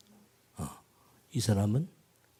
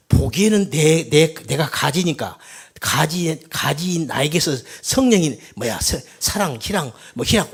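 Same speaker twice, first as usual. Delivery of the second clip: loud, emphatic, slowed start; forceful preaching; 3 semitones higher, 10 dB louder than usual. Acted. Pastor preaching the fruits of the Spirit, loud and emphatic. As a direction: hammer out the list of fruits.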